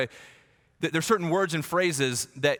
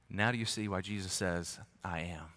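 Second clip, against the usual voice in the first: hushed question; 7 semitones lower, 9 dB quieter than usual. Real, not acted.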